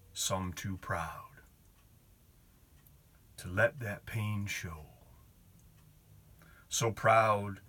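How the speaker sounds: slow, weighty poem recital; poetry reading. Acted. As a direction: read it like a solemn poem. Reads a poem aloud.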